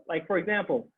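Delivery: normal talking voice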